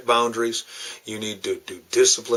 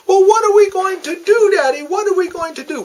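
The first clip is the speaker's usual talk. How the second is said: put-on high, loud, frantic child wailing; panicked little girl; 7 dB louder than usual. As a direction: beg Daddy in a frantic high wail. Daughter panicking, asks Daddy.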